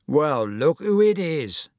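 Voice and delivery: slow, loud, drawn-out taunt; mocking greeting. Bully spotting his victim, slow mocking greeting.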